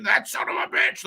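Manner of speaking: imitated commentator's scream; high-pitched mock yell, not full volume; Vince. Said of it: "he would scream".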